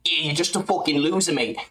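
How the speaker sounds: mock the fighter insulting someone; imitating a fighter. Streamer quoting fighter on podcast, mild put-on voice. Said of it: "Him talking to Anthony Smith".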